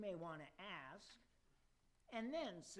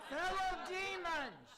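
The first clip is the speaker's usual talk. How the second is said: high, loud cry over the din; loud rebuke; 10 semitones higher, 9 dB louder than usual. Acted. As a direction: shout the demons back to order. Chairman restoring order.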